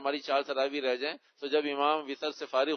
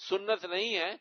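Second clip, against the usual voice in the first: normal talking voice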